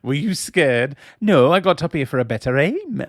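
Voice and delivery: hammed-up, badly done Welsh accent; terrible Welsh accent. Host acts out Evans's lines, bad Welsh accent. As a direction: lilt it in a shaky attempt at Welsh. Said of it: "my terrible Welsh accent".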